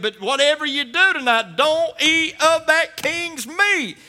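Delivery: high, loud, drawn-out plea; preaching climax. Acted. Preacher warning the congregation, high and slow. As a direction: stretch every word out slowly in warning. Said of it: "preaching climax".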